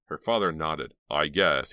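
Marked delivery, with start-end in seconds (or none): none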